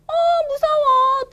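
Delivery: dog near river, frightened squeal; mock scared.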